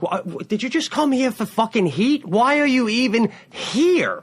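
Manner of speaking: high, incredulous plea to the crowd; baffled comic begging the room to explain itself; exasperated disbelief. Retold or imitated. Comic on stage asking the crowd why, exasperated.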